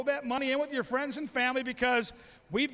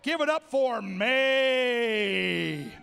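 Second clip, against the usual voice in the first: slow drawn-out name call; announcer hyping the crowd for each corgi; ring announcer intro; 2 semitones lower, much slower than usual. Acted. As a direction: stretch the name like a boxing intro.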